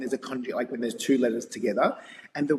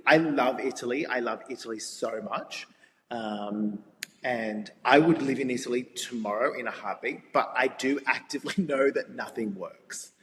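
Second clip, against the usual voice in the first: ordinary talk, nothing marked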